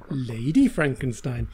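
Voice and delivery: low, slow repeat of the title; mock-dramatic title. Shown as a demonstration. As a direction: savour the lurid title, dropping low. Title repeated for comic effect.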